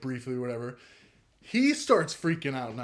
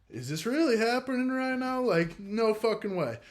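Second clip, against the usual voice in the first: much higher, in shocked disbelief; stunned disbelief; 9 semitones higher, 4 dB louder than usual. Retold or imitated. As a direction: gasp it out like you can't believe your eyes. Retold disbelief at man screaming.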